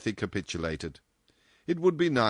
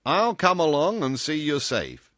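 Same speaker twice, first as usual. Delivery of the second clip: loud, higher, businesslike announcement; matter-of-fact; 8 semitones higher, 6 dB louder than usual. A narrator's reading. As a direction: state it as settled. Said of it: "he announced in a matter-of-fact tone".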